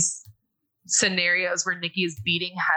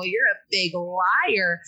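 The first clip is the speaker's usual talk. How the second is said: usual speaking voice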